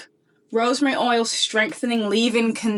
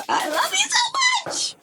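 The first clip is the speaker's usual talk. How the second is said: much higher, slow squeal of love; gushing squeal; slower than usual. Real, not acted. Thanking Umberto Giannini for the gift, a much higher gushing squeal.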